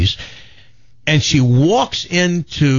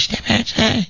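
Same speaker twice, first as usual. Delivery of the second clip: high, put-on old lady voice, defiant; an eighty-eight-year-old demanding her place; feisty old granny; 8 semitones higher, 4 dB quieter than usual. Granny telling the Hells Angels she wants in, in a high put-on old voice. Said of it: "She says".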